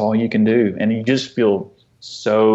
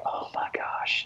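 slow, hushed whisper of dismay; imitating a stunned, whispered reaction; 11 dB quieter, slower than usual. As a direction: whisper it slowly, overwhelmed. Overwhelmed parents' reaction, retold.

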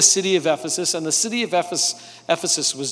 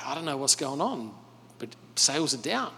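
glum aside; 3 semitones lower, 9 dB quieter than usual. Retold or imitated. Artisan voiced in retold story.

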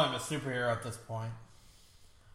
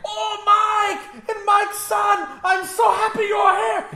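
much higher, louder gushing greeting; overjoyed Russian friend; 6 dB louder, slower than usual. Retold or imitated. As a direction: greet them like a long-lost family member. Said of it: "He's like".

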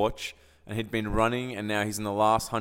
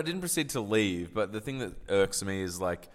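ordinary talk

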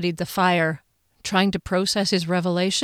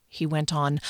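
ordinary delivery, nothing marked

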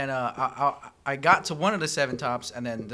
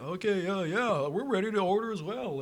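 mock customer voice; 5 semitones higher than usual. Voicing other table.

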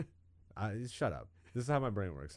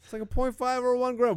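higher, louder insistent repeat; repeating it louder to a hesitant friend; 12 semitones higher, 5 dB louder than usual. Real, not acted. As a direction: say it again, pushing hard.

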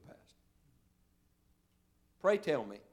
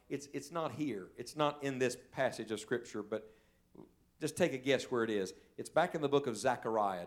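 ordinary talk

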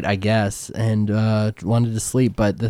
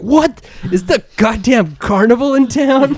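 shocked shopkeeper; 10 semitones higher, slower than usual. Acted. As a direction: squeal it out like you can't believe your eyes. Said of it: "he's like".

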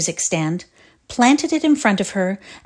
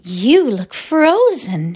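higher, louder and slow, honeyed and mocking; sugary; 4 semitones higher, 4 dB louder, slower than usual. A narrator's reading. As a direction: coo it with mock sweetness. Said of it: "The other smiled her sugary smile".

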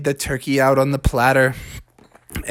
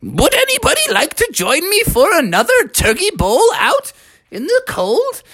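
very high-pitched cartoon grandma; high old-lady voice, feeling great; feisty grandma. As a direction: invite them in a high, perky granny voice. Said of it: "feeling so good that she said".

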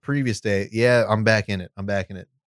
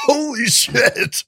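breathless holy shit; 10 semitones higher, much slower than usual. Real, not acted. After the harsh shot.

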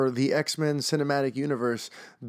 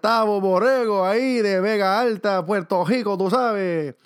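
put-on terrible Spanish accent; 9 semitones higher, 8 dB louder than usual. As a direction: belt it out in a bad Spanish accent. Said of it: "so I can do a terrible Spanish accent".

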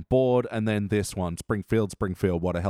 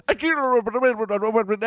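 high dazed cartoon kid; echoing, dizzy kid voice; 9 semitones higher, 3 dB louder, faster than usual. Bart quote echoing, high voice.

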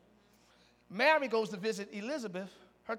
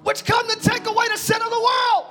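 loud, higher, stammering overwhelmed cry; John the Baptist awestruck; 8 semitones higher, 7 dB louder than usual. Retold or imitated. John the Baptist overwhelmed at seeing Jesus, shouting. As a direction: trip over your words in awe. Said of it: "John is like".

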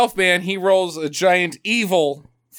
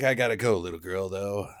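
mock tough hero; 8 semitones lower than usual. Elfman says goodbye to little girl, low mock stoicism. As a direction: play a stoic working man, low and understated. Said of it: "uh, he's like".

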